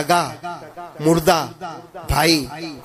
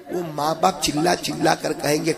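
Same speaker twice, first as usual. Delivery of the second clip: ordinary talk, nothing marked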